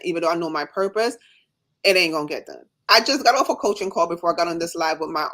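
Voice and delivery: ordinary talk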